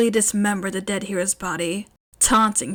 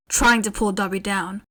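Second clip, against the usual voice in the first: plain reading voice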